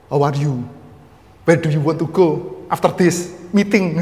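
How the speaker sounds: slow English with a Javanese lilt; Javanese-accented English. Shown as a demonstration. Joking that his English sounds funny, slow Javanese accent. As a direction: put on a Javanese accent, drawing the words out. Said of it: "Kejawa-jawaan gitu".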